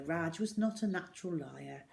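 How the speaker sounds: usual speaking voice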